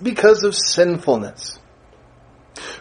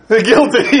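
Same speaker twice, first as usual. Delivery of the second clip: mock judge verdict; 3 semitones higher, 4 dB louder than usual. Judge slams gavel in story.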